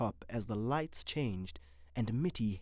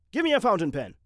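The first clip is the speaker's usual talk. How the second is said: louder, higher curt snap; snapped order; 8 semitones higher, 8 dB louder, faster than usual. A narrator's reading. Mitty snapping an order in surgery, curt and loud. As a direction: bark the order at the staff. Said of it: "he snapped".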